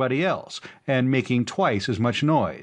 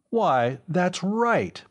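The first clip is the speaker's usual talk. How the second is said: exclaimed; 9 semitones higher, much slower than usual. A narrator's reading. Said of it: "Professor Kelton exclaimed".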